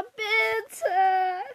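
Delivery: loud, high, drawn-out whiny plea; begging. A narrator's reading. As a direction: stretch BITTE into a pestering beg. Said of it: "bettelte Kara". (laut und langgezogen bettelnd)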